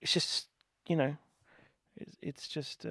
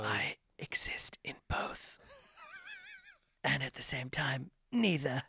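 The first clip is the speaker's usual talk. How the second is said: breathy theatrical whisper, mock profound; mock dramatic whisper; 4 semitones higher, 4 dB quieter, slower than usual. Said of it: "that was a performance".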